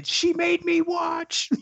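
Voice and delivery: high, slow shrieking quote; hammed-up high-pitched wail; mock screaming girl. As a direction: shriek it like the Sin City girl. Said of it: "screaming like that one girl from Sin City".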